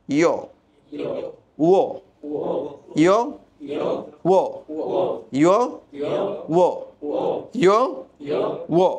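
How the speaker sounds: short isolated ইয় and ও, spaced for echo; rounded-mouth articulation. Shown as a demonstration. Teacher drilling Y and W, rounded-lip sound demo. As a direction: alternate ইয় and a round-lipped ও. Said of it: "মুখটা গোল করে উচ্চারণ করতে হয়".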